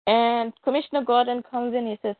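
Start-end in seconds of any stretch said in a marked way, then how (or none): none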